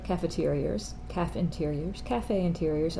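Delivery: cafeterias, caf interiors, cafe interiors mumbled softly; quietly toying with how cafeterias sounds; soft wordplay on cafeterias; playing with the word. Shown as a demonstration. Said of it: "Okay, stop".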